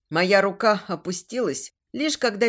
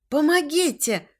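high, urgent call for help; crying for help; 7 semitones higher than usual. A narrator's reading. Heroine calling nearby shifters for help, high cry. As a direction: cry out so others will hear. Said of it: "крикнула я".

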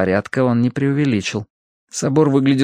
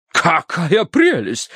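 slow, high-pitched delight; enthused; 7 semitones higher, much slower than usual. A narrator's reading. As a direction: savour it in a drawn-out, high voice. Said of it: "воодушевилась миссис Меринг". (воодушевлённо, высоко и протяжно)

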